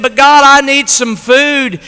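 imitated high-pitched, loud plea; pleading prayer. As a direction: plead with God like a needy old lady. Old lady pleading in prayer.

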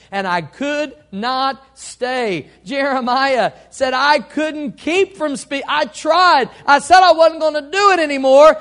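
loud, high-pitched pulpit proclamation; full-force preaching. Real, not acted. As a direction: preach it out, building in volume and pitch.

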